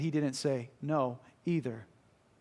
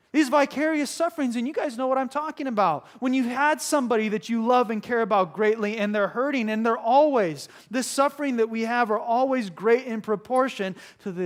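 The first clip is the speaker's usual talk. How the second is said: raised, louder pulpit delivery; full-force preaching; 6 semitones higher, 5 dB louder than usual. Real, not acted. Preacher to listeners who know this hurt, emphatic. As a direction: press the point on the congregation.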